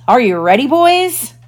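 loud ringing call, swooping high at the end; rally the gang playfully; mischievous. A narrator's reading. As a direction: boyish rallying shout, rising high. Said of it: "Out rang Peter's mischievous voice".

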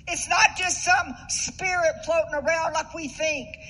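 loud, rising preacher's fervour; preaching at full force. Acted. Pastor exhorting the congregation about God's breath, fervent and loud. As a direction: drive the point home from the pulpit.

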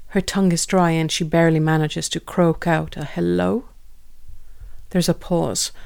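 ordinary delivery

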